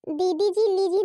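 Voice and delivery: ordinary talk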